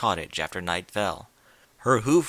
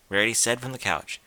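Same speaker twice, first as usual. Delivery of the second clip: usual speaking voice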